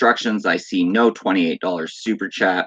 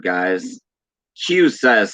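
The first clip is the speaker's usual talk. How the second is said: ordinary talk, nothing marked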